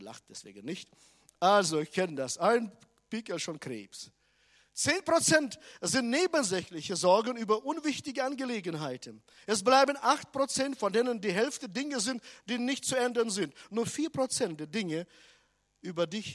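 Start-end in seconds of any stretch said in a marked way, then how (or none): 1.4-4.1 loud, fast preacher's proclamation; full-force preaching. Acted. Preacher hammering the statistic to the congregation, loud and fast. 4.7-16.2 loud, fast preacher's proclamation; full-force preaching. Acted. Preacher hammering the statistic to the congregation, loud and fast.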